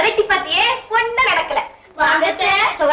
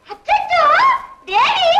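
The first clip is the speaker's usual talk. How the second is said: high squeal breaking off the story; excited greeting; 9 semitones higher, slower than usual. Acted. Visitor interrupts her story on seeing Devi, excited squeal.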